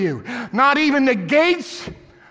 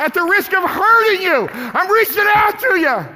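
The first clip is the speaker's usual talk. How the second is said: climbing higher and louder to a shout; pleading shout; 6 semitones higher, 3 dB louder than usual. Real, not acted. Preacher crying out to a lost listener. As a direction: reach out with a full-voiced plea.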